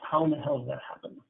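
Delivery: quick, baffled mutter to yourself; rattled, wondering what just hit you; a therapist left alone after the client storms out, baffled; bewildered. Retold or imitated. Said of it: "you walk away going".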